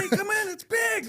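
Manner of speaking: put-on high, loud little boy calling out; excited kid shout. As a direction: yell like a proud kid calling dad in. Kid voiced calling dad in.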